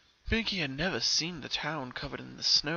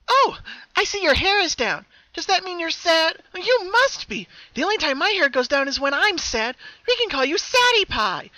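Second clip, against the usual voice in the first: very high and loud, perky; happy Pinkie nicknaming her double, sing-song; 9 dB louder than usual. Acted. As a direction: chirp it with cheery cruelty. Happy twin names her Sadie.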